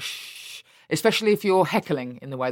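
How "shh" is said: shushing. Retold or imitated. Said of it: "they're like".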